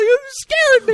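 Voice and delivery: very high, loud hammed-up wailing; mock screaming row. Pratt blames Howard for the dead dinosaur, hammed-up screaming. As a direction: shriek the blame in mock hysteria.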